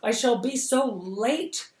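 high, loud and slow, frustrated; crying out. Acted. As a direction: cry out in exasperation. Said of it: "crying out".

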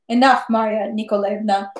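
plain reading voice

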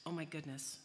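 usual speaking voice

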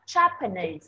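"Japanese" as JA-pa-nese, first syllable hit; stress on first syllable. Shown as a demonstration. Teacher contrasting it with the country, "Japanese" stressed on JA. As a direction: lead hard on the JA of "Japanese". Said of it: "chữ Japanese là nhấn âm thứ nhất".

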